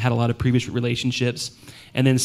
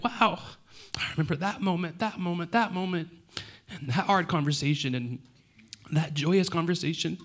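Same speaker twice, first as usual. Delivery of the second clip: higher and softer, moved; soft emotional; 5 semitones higher, 6 dB quieter than usual. Real, not acted. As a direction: speak softly, close to tears. Looking out at faces, emotional.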